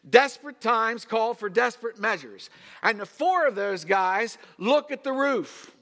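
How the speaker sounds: high, loud pulpit delivery; full-force preaching. Real, not acted. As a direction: preach it out to the whole room.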